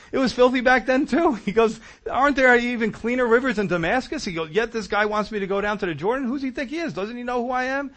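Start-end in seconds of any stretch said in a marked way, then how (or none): 0.1-1.3 high, louder indignant retort; irate. Retold or imitated. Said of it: "he gets really irate". 2.1-4.2 high, louder indignant retort; irate. Retold or imitated. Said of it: "he gets really irate". 4.5-7.9 high-pitched, affronted acted complaint; irate. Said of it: "he gets really irate".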